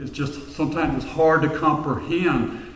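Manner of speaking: ordinary delivery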